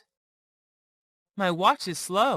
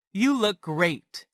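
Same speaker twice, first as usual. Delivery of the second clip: ordinary talk, nothing marked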